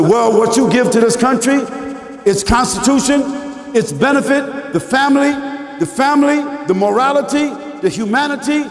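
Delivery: high, rhythmic list building on each item; emphatic preached retort. Retold or imitated. Preacher retelling his answer in a discussion, emphatic preached retort.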